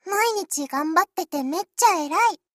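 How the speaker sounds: high, loud cutesy anime voice; anime girl voice. Acted. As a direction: squeal it like a cute idol ad. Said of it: "真似してみたい".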